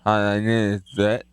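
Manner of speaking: holding tongue with fingers. Shown as a demonstration. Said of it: "Grab your tongue with your hands. Now try to talk".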